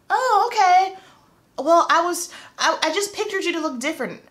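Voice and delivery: high-pitched imitation of an awkward client; put-on high, halting surprise; taken-aback client. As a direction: mimic someone thrown off and covering it. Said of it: "she was shocked".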